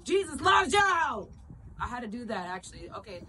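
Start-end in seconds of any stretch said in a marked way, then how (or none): 0.0-1.3 high, long belted shout; shout from diaphragm. Shown as a demonstration. Demonstrating for viewers how to witness, shouted from the diaphragm. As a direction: belt it from the diaphragm, words stretched out. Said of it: "saying it with your diaphragm".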